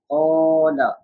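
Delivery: "Qala" with a long stretched alif; stretched long vowel. Shown as a demonstration. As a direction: hold the "a" in "Qala". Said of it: "so we are stretching".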